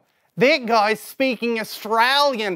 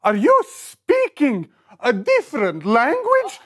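ordinary delivery

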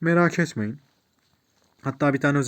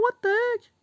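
surprised exclamation. Real, not acted. Surprised by odd quest.

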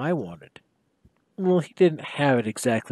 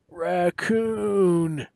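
mumbled thickly; 4 semitones higher than usual. Acted. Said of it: "he mumbled thickly".

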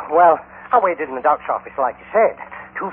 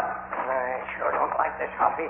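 slow and much softer, uneasy; nervous hush; 4 semitones lower, 9 dB quieter, slower than usual. Acted. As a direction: keep your voice down in the rotten tunnel. Nervous in collapsing mine tunnel.

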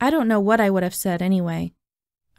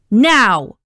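very loud, impatient command; explosive director's yell; shouted order; 2 semitones higher, 8 dB louder than usual. A narrator's reading. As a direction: shout it as an urgent demand. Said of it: "shouted Davenport in his face".

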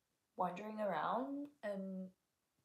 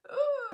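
mock ooh; 11 dB louder than usual. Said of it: "And he's like".

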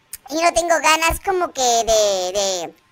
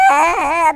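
long mock-crying wail; fake wail. Player sits down to cry over eviction, fake wail. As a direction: bawl theatrically, drawn out. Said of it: "Voy a sentarme aquí a llorar".

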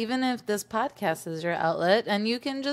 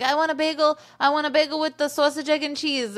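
higher, fast New York order; Brooklyn accent let loose; 8 semitones higher than usual. Retold or imitated. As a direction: bark the bagel order like a true New Yorker. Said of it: "I'm like".

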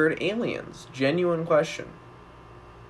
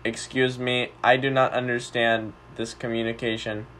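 put-on old speech synthesizer; 6 semitones lower, 6 dB quieter than usual. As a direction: speak like a stiff alien robot. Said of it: "blind alien and human roleplay".

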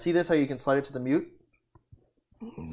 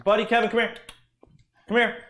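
loud call to the dogs; 10 semitones higher, 9 dB louder than usual. Real, not acted. Calling dogs over.

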